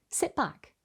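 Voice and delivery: ordinary delivery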